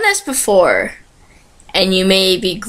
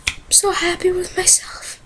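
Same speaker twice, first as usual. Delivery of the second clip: high, soft whisper to herself; 9 semitones higher, 7 dB quieter than usual. Real, not acted. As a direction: whisper it, proud and giddy. Pleased aside after success.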